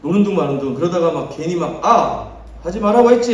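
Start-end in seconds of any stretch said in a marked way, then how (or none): 1.8-3.3 mock angry snap at the kids; irritated scold. Dad retelling how he snapped at his kids, irritated. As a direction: snap at them, half-annoyed, half-playing. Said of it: "하면서 막 화내고".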